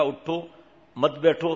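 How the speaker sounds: اٹھو set apart, then مت بیٹھو as one phrase; pause after اٹھو. Shown as a demonstration. Preacher correcting the misquote, pause after اٹھو. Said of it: "پھر یہ لہجہ تھا".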